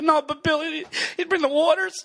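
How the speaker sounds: high, pleading fake-weepy voice; hammed-up emotional wobble; mock choked up. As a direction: protest through pretend tears. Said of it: "he gets a little emotional".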